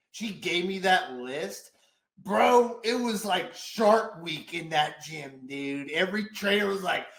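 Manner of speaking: ordinary talk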